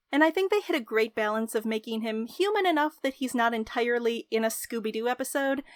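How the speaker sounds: ordinary talk, nothing marked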